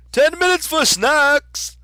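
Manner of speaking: high, loud, drawn-out announcement; calling to crowd. A narrator's reading. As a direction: holler it across a whole crowd. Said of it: "the king called to the crowd".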